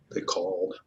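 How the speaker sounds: soft whispered echo of they called; whispered repeat. Shown as a demonstration. Father told of his child's call, softly whispered. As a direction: breathe it out like a secret.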